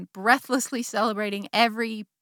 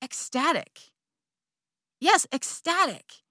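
savoring ecstatic; 6 semitones higher than usual. Retold or imitated. Savoring the memory.

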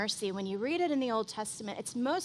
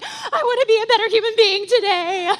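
high, loud and teary, half laughing; a hammed-up sob through a laugh; mock tearful crying; 9 semitones higher, 11 dB louder than usual. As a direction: choke up as if moved to tears at a red light. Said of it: "sitting in our cars crying".

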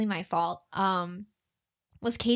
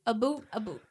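mock Canadian accent. Shown as a demonstration. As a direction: joke Canadian "about", said "a boot". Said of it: "I think it's like a boot".

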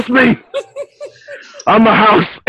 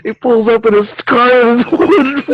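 ordinary talk

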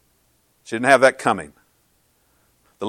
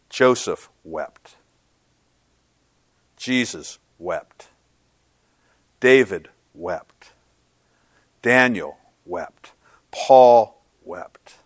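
slow solemn litany; 2 semitones lower, much slower than usual. Acted. Slow solemn list of weeping.

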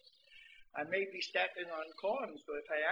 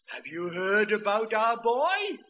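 put-on loud, slow proud old man; an old man savoring the news; slow, loud old man's question; 10 dB louder, slower than usual. Old man voiced in story.

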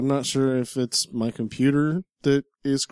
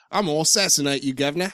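put-on quick, comic British-accented threat; mock British accent; 3 semitones higher, faster than usual. Parodying the game's French characters, comic British accent. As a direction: threaten like a cartoon Cockney. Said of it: "The rest are all Scottish or British".

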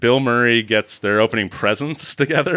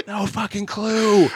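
higher, slow, drawn-out disbelief; exasperated; 10 semitones higher, slower than usual. Real, not acted. Complaining to co-host about the edit, slow exasperated. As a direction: stretch it out in mock exasperation. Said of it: "exasperated emphasis".

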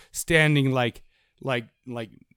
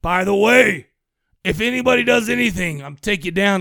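loud, raised sheriff's warning; 3 semitones higher, 5 dB louder than usual. Acted. Sheriff taking over the town meeting, loud warning. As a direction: lay down the law as a mock sheriff. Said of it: "The sheriff takes over. He's like".

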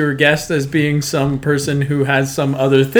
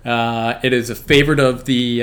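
ordinary delivery

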